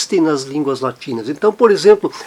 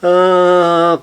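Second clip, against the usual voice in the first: ordinary talk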